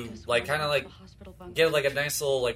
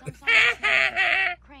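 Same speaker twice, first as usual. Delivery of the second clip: Kitty Foreman laugh; 5 dB louder than usual. Retold or imitated. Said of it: "I know I couldn't laugh like Kitty Foreman".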